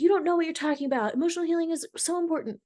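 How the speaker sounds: combative comeback. Retold or imitated. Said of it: "getting angry and combative".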